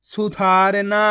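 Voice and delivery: teacher stretching सुधारना out for the class; slow full reading. Shown as a demonstration. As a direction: read सुधारना out slowly for the class. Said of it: "किस तरीके से ये शब्द पढ़े".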